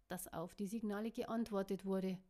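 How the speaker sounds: ordinary delivery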